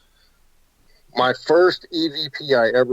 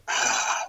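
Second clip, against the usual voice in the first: long audible exhale, mimicking someone dying; imitated last dying breath; 6 dB quieter than usual. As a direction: breathe out like a final breath leaving the body. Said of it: "like a guy's last dying breath".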